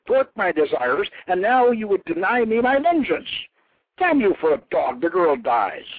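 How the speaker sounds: high, loud, rising rage, quick at the start; furious tirade. A narrator's reading. Anath rails at Baal, loud and furious. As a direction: hurl every grievance at him.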